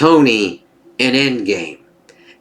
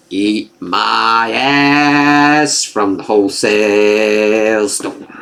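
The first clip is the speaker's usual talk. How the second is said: mock taunting show; 4 semitones lower, much slower than usual. Imitating the show taunting viewers.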